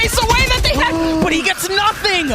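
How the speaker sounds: high loud excited call; esports caster. Real, not acted. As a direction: shout the play as it happens.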